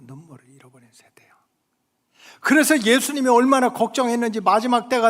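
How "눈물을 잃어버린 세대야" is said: whispered echo. Real, not acted. Preacher echoing his lament, whispered. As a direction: repeat it as a bare whisper. Repeated line, then whispered.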